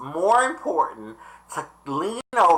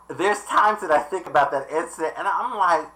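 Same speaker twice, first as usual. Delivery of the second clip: ordinary talk, nothing marked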